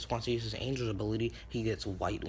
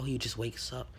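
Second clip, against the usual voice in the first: ordinary delivery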